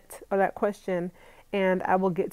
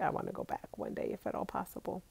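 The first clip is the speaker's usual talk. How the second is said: soft unvoiced whisper of a longing; whispered wish; 12 dB quieter than usual. To her viewers, recalling Grenada, whispered wish. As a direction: breathe it out like a secret wish. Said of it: "I was like".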